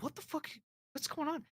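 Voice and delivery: high, hushed bewilderment; retold puzzled aside, softly and high; baffled muttering. Technician to himself watching a cop at a customer's door, hushed bafflement.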